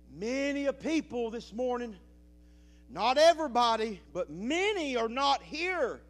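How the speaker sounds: loud, high, fervent preaching; preaching at full force. Real, not acted. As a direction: pour it all out to the church. Preaching to the congregation.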